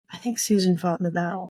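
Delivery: ordinary talk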